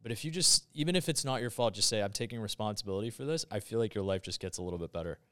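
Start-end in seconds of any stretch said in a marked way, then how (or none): none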